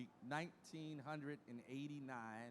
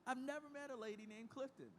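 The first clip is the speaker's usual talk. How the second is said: surprised; 10 semitones higher, 4 dB quieter than usual. Retold or imitated. Amazed at her name.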